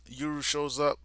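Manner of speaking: ordinary talk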